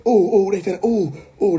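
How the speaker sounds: high-pitched teasing oohs; hammed-up oohing; mock alarm. Mock alarm after teasing about butters.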